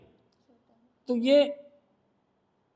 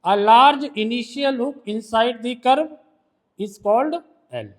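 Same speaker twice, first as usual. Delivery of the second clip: slow dictation; much slower than usual. Shown as a demonstration. Teacher dictating a shorthand rule.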